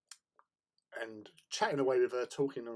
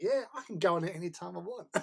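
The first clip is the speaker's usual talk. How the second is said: higher-pitched mock American boast; American accent; 8 semitones higher, 3 dB louder, faster than usual. Showing off to the fooled cubs, mock American accent. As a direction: brag in a put-on American accent. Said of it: "put on this American accent".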